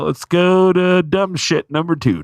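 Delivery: slow, drawn-out mocking call; slow mock headset call; mocking crew call. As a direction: sneer it like a lighting tech on comms. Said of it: "They go".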